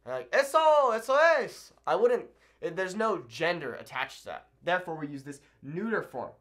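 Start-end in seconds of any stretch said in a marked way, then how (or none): 0.3-1.7 loud, high cheer of approval; approving exclamation. Acted. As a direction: call it out like cheering someone on. Demonstrates cheering approval in Spanish.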